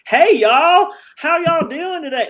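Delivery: high, loud Texan drawl parody; mock Southern accent. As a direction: ham up a Southern Texan greeting. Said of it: "very Southern or Texan".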